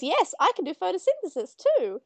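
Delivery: chirpy plant voice. Retold or imitated. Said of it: "And then she says".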